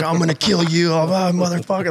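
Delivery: mock furious yelling. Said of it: "dropping gnarly F-bombs".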